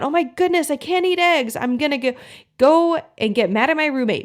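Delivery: high, hammed-up panic; mock freak-out. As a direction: play the brain freaking out over the eggs. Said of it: "your brain starts freaking out".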